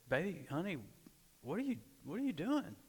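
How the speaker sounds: retold puzzled question, lifting high; puzzled husband. Husband waking at night to wife pacing, puzzled.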